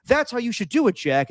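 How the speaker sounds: high, pleased mock director; lightly hammed director finishing his demo; imitating the director. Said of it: "Rob's like".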